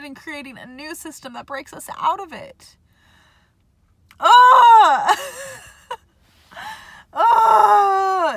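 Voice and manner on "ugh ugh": loud, high cry of pure frustration; frustrated shout. Real, not acted. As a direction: let out a big exasperated Ugh!. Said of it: "It's so frustrating".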